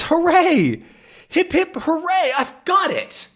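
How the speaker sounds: high, jubilant cheer; hammed-up hip hip hooray. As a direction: celebrate like you cracked it. Tintin cheers triumphantly.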